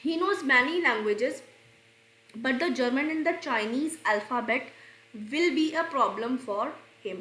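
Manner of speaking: slow, careful read of the sentence; slow dictation. Shown as a demonstration. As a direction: read it out word by word for students. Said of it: "reads example sentence slowly".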